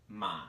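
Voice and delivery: "Ma" dipping down then slightly back up; "Ma" low, dipping tone; third tone ma. Shown as a demonstration. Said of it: "goes down and then slightly back up".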